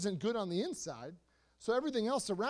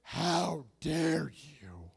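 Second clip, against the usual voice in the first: drawn-out, voiceless gasp of indignation; outraged; 6 semitones lower, much slower than usual. Acted. Offended dater to a disapproving friend, outraged hiss. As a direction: stretch each word in whispery, offended disbelief.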